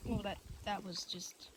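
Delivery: recovering from choking on a bug; just swallowed a bug. Real, not acted. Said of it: "I just swallowed a bug".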